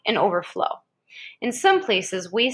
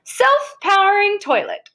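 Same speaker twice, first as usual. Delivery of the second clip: much higher and louder, slow showy heading; grand announcement; 10 semitones higher, 5 dB louder than usual. Acted. Reading a book to a child, showy announcement. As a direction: announce it like a game-show prize.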